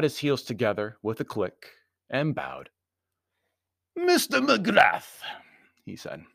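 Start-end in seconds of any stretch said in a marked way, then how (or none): 3.9-5.2 very high, loud, slow greeting; formal foreign Baron. A narrator's reading. Baron greeting his host, high formal voice. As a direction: announce the name slowly in a high Baron's voice.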